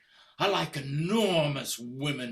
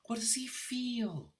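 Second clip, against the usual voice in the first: whispering; 5 semitones higher, 6 dB quieter than usual. Acted. Husband imagined at office.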